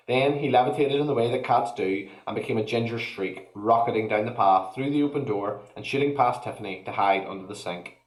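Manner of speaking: plain reading voice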